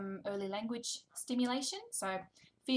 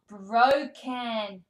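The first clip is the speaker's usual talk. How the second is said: loud drawn-out Broken; mock dismay; 2 semitones higher, 11 dB louder than usual. Shown as a demonstration. Reading to toddler, loud mock dismay. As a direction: stretch Broken in mock shock. First take.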